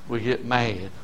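ordinary talk, nothing marked

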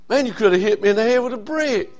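ordinary delivery

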